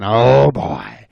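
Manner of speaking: slow, relishing growing hunger; hungry bobcat. Acted. Bobcat eyeing the animals, slow hungry relish. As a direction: draw the words out greedily. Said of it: "rubbing his paws together and saying".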